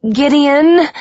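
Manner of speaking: high, loud cry of his name; panicked shout. A narrator's reading. Woman struggling to get away, panicked. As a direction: shout to break through to him.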